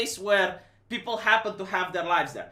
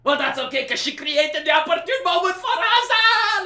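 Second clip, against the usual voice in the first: much higher and louder, shouting through laughter; higher, louder, laughing manic glee; gleeful greedy villain; 9 semitones higher, 7 dB louder than usual. Retold or imitated. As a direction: cackle over the crisis like a cartoon villain. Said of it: "This is how I hear it".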